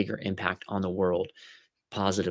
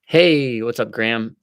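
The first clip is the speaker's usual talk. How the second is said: ordinary delivery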